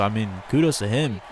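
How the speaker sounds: ordinary delivery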